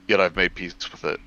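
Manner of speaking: usual speaking voice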